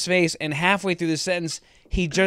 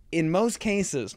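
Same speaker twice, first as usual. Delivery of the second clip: usual speaking voice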